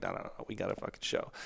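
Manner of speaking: soft, half-whispered no; hushed refusal. Retold or imitated. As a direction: brush it off under the breath. Refusing rehab, quoted self.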